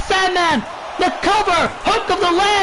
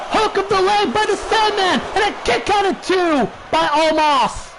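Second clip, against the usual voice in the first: wrestling commentator; 3 semitones higher than usual. Acted. Live play-by-play of a match.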